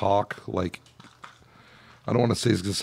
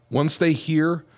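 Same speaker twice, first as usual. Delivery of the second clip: normal talking voice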